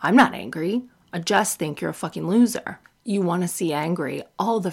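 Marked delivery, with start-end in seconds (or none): none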